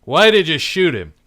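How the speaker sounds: sharp, loud interrogation; detective barks his accusation at her; angry demand. Acted. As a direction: cut her off and demand the truth. Detective confronts the killer.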